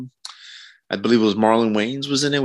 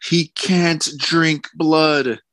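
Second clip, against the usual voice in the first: mocking high voice; 7 semitones higher, much slower than usual. Mocking Sony's objection.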